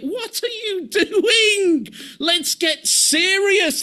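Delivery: high, slow, laughing near-shout; a half-laughing shout to wake them up; exasperated cry. Retold or imitated. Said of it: "He's like".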